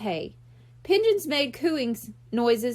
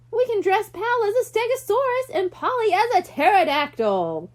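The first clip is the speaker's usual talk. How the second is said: put-on excited kid; 8 semitones higher, 4 dB louder than usual. A narrator's reading. Brian's excited dinosaur idea.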